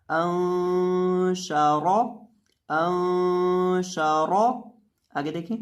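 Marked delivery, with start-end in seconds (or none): 0.1-4.4 अंशारा chanted slowly with a heavy, full रा and a nasal noon; heavy raa. Shown as a demonstration. Teacher showing students the heavy raa, slow chanted reading. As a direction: sing अंशारा slowly, making the रा thick. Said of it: "इसको पढ़ेंगे".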